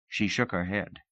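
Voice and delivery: ordinary delivery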